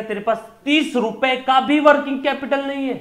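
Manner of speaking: high, loud mock yell, punching तीस; mock angry shout. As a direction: shout it at the vendor like you've lost patience. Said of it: "तो सोचो कितना गुस्सा आएगा".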